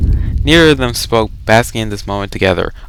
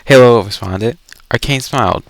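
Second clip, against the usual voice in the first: usual speaking voice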